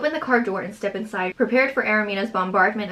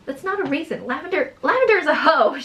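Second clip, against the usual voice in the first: higher, louder outburst building on the name; angry, abrupt; 7 semitones higher, 4 dB louder than usual. A narrator's reading. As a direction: burst out in acted indignation. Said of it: "she replies angrily and abruptly".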